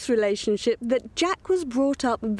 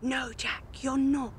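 hushed prompt; 6 dB quieter than usual. Acted. Mother whispering a correction to son.

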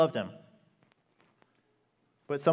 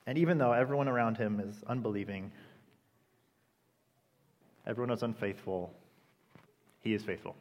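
normal talking voice

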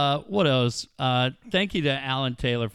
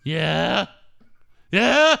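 crank it up from mutter to yell; building yeah; 7 dB louder, much slower than usual. Retold or imitated. Juiced-up wrestler answering the reporter, building to a yell.

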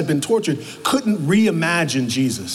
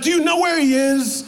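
high, urgent frantic plea; desperately asking where he is; 9 semitones higher than usual. Retold or imitated. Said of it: "she's frantic".

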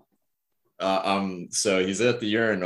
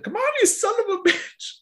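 put-on high, raspy old man cursing; Herbert voice. Old patient straining at the urinal cursing himself, raspy high old man voice. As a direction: curse at it like a fed-up old codger. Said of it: "yelled and said".

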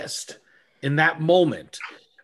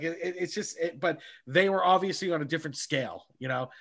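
ordinary talk, nothing marked

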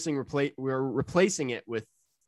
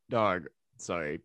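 ordinary delivery

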